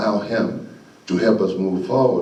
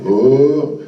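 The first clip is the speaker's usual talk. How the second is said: drawn-out, louder mock "whoa"; classmates' reaction; 3 semitones higher, 5 dB louder than usual. Whole classroom watching the standoff, imitated awed reaction. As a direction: imitate a roomful of watching kids. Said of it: "they're like".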